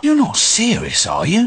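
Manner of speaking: can't believe what he's hearing; wizard's assistant aghast at his master; disbelieving. Acted. Disbelief at the impossible task.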